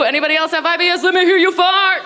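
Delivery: high, rallying hype shout; crowd hyping. Pretend. Comic asks crowd who has IBS, hyped-up call. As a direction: work the room like a party host.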